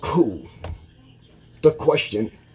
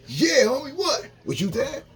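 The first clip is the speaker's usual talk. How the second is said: high, indignant mock comeback; mock defensive retort; 12 semitones higher than usual. Imitating the gossiper snapping back, high and indignant. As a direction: play the offended gossiper, squeaky. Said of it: "they're like".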